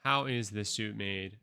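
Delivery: loud, emphatic announcer build, then easing off; podcast host intro. Acted. Host launching listeners on a journey, announcer delivery. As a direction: launch the show with mock grandeur.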